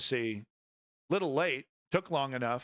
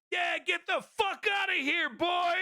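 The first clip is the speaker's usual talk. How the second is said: mock crowd yell. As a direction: belt it out like a heckler in the crowd. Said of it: "But he goes".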